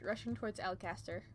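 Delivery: ordinary delivery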